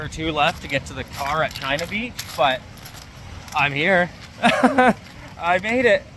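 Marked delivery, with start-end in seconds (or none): 4.3-6.0 high, slow laugh through words; laughing relief. Real, not acted. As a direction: laugh it out, finally there. Reaching the beach exhausted.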